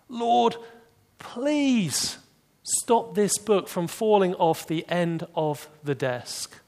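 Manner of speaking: high, slow mock-desperate plea to the Lord; pleading prayer. Pastor mocking the man's prayers, hammed-up pleading. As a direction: beg God, hammed up and slow.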